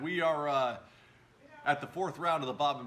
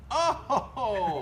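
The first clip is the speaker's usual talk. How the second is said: surprised cry; 10 semitones higher, 7 dB louder than usual. Real, not acted. Missed putt reaction.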